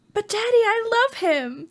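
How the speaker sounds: high, pleading princess voice; Little Mermaid voice. Retold or imitated. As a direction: sing it like Ariel begging her father. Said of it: "which is the Little Mermaid".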